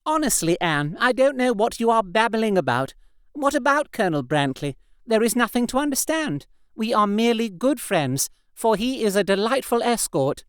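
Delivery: brushing off her friend's teasing; Ursula's voice. A narrator's reading.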